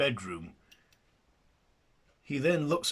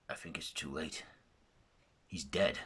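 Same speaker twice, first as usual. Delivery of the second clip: plain reading voice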